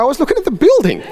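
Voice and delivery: high, fast, laughing it off; sheepish giggly defence to his wife; laughing excuse. Retold or imitated. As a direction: blurt it out through a laugh.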